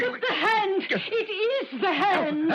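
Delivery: high sobbing cry; terrified cry. Acted. Alexis crying out as the hand chokes Gordon, sobbing terror. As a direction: wail it out in horror. Woman sees hand attack.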